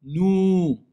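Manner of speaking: Nous as a long, pure "nouu"; sustained "nouu" on Nous; correct vowel. Shown as a demonstration. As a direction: hold Nous on a tight, steady OU. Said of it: "C'est pas ah".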